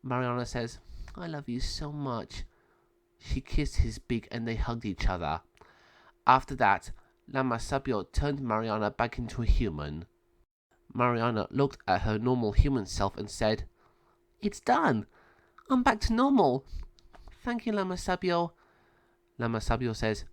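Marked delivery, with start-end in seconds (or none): 1.2-2.4 soft, quiet loving words; tender farewell. Acted. Mariana to Penelo before turning human, soft tender voice. 14.4-18.5 put-on high, light girl voice; lightly voiced grateful girl; relieved girl. Mariana thanks Lama Sabio, high relieved girl voice.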